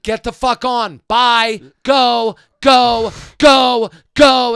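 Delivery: loud, high repeated go go go; screaming. Retold or imitated. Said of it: "And I'm screaming at her".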